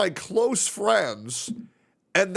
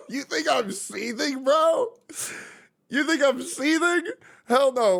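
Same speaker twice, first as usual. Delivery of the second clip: mock wrestling promo; 9 semitones higher than usual. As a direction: cut a promo at the hater. Wrestling promo reply to a hater.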